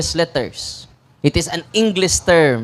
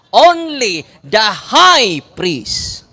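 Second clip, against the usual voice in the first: slow emphatic answer; much slower than usual. Shown as a demonstration. Drilling answer to audience.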